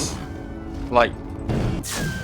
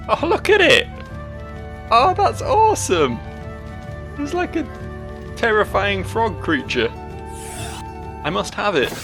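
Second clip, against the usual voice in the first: excited; 11 semitones higher than usual. Real, not acted. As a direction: gush over a cute creature. Spots new creature to catch.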